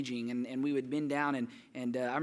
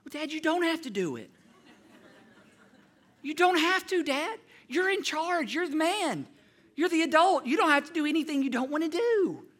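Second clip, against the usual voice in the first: put-on high, bright-eyed kid bargaining with his dad; pleading child; 13 semitones higher, 8 dB louder than usual. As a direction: plead high like a clever little boy getting out of a whipping. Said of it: "So you know what I said?".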